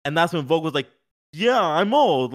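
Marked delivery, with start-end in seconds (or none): 1.3-2.3 put-on higher, louder, very slow yeah, I'm old; slow, irritated admission. Volk tired of being called old, slow and annoyed. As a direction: drag out "Yeah, I'm old." as if the teasing is wearing on him. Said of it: "like, slowly, like, it getting to him".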